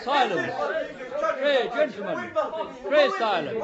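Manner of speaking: loud, high call to order; commanding call for silence. Acted. Inquisitor silencing the quarrelling gentlemen, loud command. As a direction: cut through the room and command silence.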